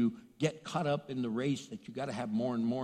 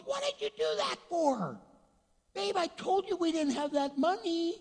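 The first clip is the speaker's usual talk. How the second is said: much higher, exasperated husband voice; upset husband. Retold or imitated. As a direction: squeal it like a shocked, scolding husband.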